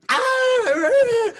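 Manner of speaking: high shrieking wail from outside; wailing cry. Retold or imitated. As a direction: let out a sudden high wail. Neighbor wailing in the yard.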